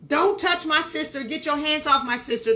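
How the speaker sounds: ordinary delivery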